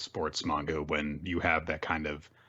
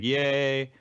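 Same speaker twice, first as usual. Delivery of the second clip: tag; 7 semitones higher, 4 dB louder than usual. Acted. Acts out Nico's cheer.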